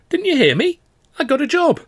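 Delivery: high and bright, pressing him; eager, insistent. Acted. Girl pressing her silent boyfriend, eager and bright. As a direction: push the happy news at him. She repeats her news.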